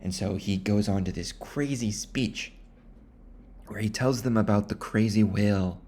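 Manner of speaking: usual speaking voice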